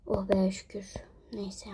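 relieved whisper; whispered relief. Real, not acted. Mother near sleeping kids, whispered relief.

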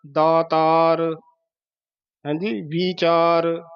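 ਦਾਤਾਰ drawn out as "daa-taar"; long vowels. Shown as a demonstration. Teacher giving a like example, slow long vowels. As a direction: stretch both vowels of ਦਾਤਾਰ slowly. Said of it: "ਕੰਨੇ ਲਾ ਕੇ ਉਚਾਰਨ ਕਰਨੇ ਹੈ".

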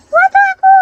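a piercing rallying yell; high battle shout. Real, not acted. Gamer charging the enemy, shouting a high battle cry.

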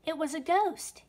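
high, loud alarmed cry. Acted. Boy panicking at the ghost, crying out high and loud. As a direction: blurt it out in a fright. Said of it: "he cried".

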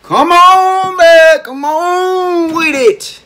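high excited urging; cheering. Real, not acted. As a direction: egg the dancer on, high. Cheering the dancer.

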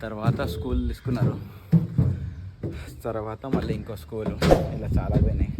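low, soft ghost-hunt voice for the camera; hushed narration. Acted. Vlogger showing the viewers the haunted college in a hushed voice. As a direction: keep it low and secretive, as if something might hear.

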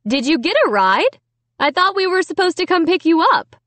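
high, carrying motherly voice; mom calling out. A narrator's reading. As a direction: call it out like a mother from the kitchen. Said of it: "Mom called from the kitchen".

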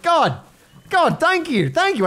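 loud, high-pitched gushing; gushing thanks. Retold or imitated. Thanking a customer for a lovely compliment, gushing. Gushes over remembered compliment.

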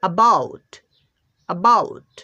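অ্যাবাউট with a weak first অ্যা; dictionary pronunciation. Shown as a demonstration. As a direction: model অ্যাবাউট, light first syllable. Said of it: "এর উচ্চারণটা পাচ্ছি". (অ্যাবাউট দুর্বল প্রথম অক্ষরে)

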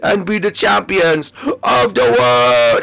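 low, slow heroic declaration; deep character voice. Acted. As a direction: proclaim victory like a deep-voiced champion. Dramatic battle speech in character.

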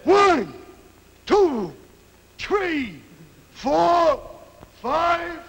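high, loud drawn-out count with falling tone; referee's count. Acted. Referee counting over a downed fighter, loud ringing count. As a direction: call it out like a ring referee. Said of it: "the referee is counting over him".

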